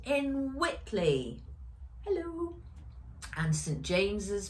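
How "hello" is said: high squeaky hello. Acted. Greeting children, squeaky high.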